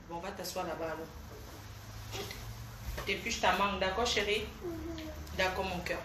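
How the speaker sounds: hushed, real motherly coaxing; gentle reassurance. Real, not acted. Gently settling the child nearby.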